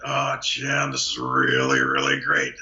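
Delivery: low Muppet Tony Romo. Retold or imitated. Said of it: "Romo's almost a Muppet".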